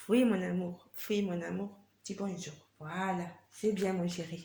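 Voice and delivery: soft, low cooing to the dog. Real, not acted. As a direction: coo gently like to a pet.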